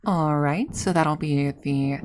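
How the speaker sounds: drawn-out order read-back with rising ends; customer service voice. Pretend. Worker reading back a silly order, mock customer service voice. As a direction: play a counter worker confirming an order. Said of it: "that's the voice you'd hear".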